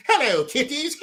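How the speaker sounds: high cackling ghoul greeting; Crypt Keeper impression. Streamer greets viewers as the Crypt Keeper, high giggling ghoul. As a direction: squeal it like a giggling corpse host. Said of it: "the Crypt Keeper".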